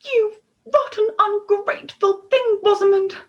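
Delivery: high, loud indignant scold; scolding queen. A narrator's reading. Queen scolding Rosamond for knocking out the fairy, loud high scold. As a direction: tell off a naughty daughter. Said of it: "said the queen".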